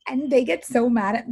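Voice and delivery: ordinary talk, nothing marked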